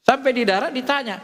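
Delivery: ordinary talk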